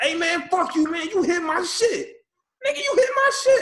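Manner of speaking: high, loud mock street yell; angry yelling. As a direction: bellow it like a furious driver. Two guys yelling at each other.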